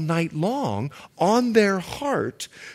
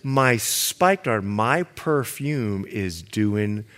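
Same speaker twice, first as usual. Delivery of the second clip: slow, lower and softer, hammed-up allure; mock sultry bride; 8 semitones lower, 4 dB quieter, much slower than usual. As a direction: draw it out like a teasing seductress. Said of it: "look what she said".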